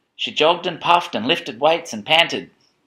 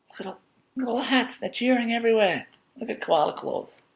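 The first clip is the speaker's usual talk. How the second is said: high, fast excited aside; thrilled, pointing at the hats; 11 semitones higher, 3 dB quieter than usual. Real, not acted.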